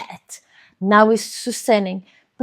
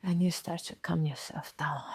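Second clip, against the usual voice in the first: soft unvoiced whisper; 4 semitones lower, 15 dB quieter than usual. Shown as a demonstration. Speaker guiding listener to calm down, whispered. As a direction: hush it down to a whisper. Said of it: "calming demonstration".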